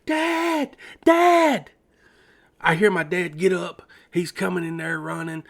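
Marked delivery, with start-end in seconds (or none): 0.0-1.7 high, drawn-out cry for Dad; re-enacted full-voiced yell, second one louder; yell at the top of lungs. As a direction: holler for Dad, pitched way up. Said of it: "I yell at the top of my lungs".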